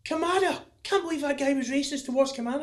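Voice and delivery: high, incredulous imitation of fans; fans' mock outrage. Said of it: "them same fans will go".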